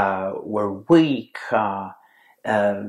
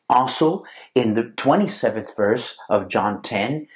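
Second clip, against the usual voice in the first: normal talking voice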